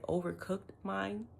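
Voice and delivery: usual speaking voice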